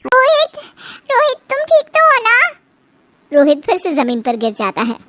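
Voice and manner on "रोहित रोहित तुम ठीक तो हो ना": higher, worried calling of Rohit; alarmed girlfriend asking if he's fine; anxious call. Acted. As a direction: rush to check he's okay. Lily worried for boyfriend.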